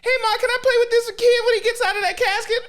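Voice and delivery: put-on very high, silly child voice; little kid. Kid asking Ma at the wake, squeaky child impression. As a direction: squeak it like an innocent kid, half laughing. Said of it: "You see the kid just like".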